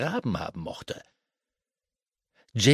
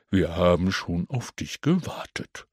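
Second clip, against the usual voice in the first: lower, calm creature voice; a deeper voice, knowing and calm; second creature voice; 6 semitones lower than usual. Acted. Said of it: "eine zweite Stimme sagte".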